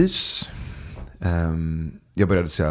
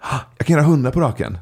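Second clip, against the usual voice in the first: quick, higher-pitched mock kid boast; boastful seven-year-old; 6 semitones higher, 4 dB louder, faster than usual. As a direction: lightly imitate a child one-upping an adult. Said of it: "så plötsligt så säger sonen". (lätt härmad skrytsam sjuåring)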